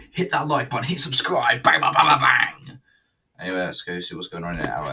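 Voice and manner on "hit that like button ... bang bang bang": high plug building to loud bangs; hyped channel plug. Pretend. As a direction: fire off a hammed-up call to subscribe. Hammed-up subscribe plug.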